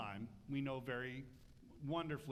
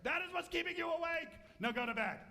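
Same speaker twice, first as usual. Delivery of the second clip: stern parent; 13 semitones higher, 4 dB louder, faster than usual. Retold or imitated. Parent scolding child at bedtime.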